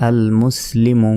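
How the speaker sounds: slow chanted reading; recited word. Shown as a demonstration. Teacher recites the joined word for students, slow chant. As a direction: read it out like Quran recitation. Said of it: "spelled then recited".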